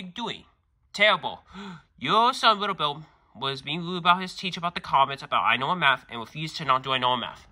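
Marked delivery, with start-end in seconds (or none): none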